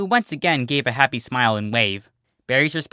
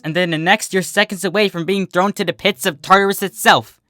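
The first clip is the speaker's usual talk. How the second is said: louder and higher, fierce warning; paranoid Bon Bon; 6 semitones higher, 5 dB louder than usual. Acted. Bon Bon warns her roommate about sea ponies, loud and fierce. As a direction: glare and insist. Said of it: "Bon Bon glared at her roommate".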